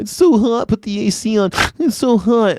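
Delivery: high-pitched mock whining; whiny overheated guy. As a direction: whine like a big baby about heat. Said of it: "Guys are always doing that".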